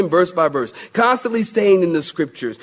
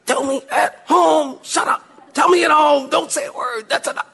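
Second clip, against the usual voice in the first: high put-on wife voice, sharp and scolding; wife hushing him; 11 semitones higher than usual. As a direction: play the wife telling him to hush now. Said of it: "And she's like".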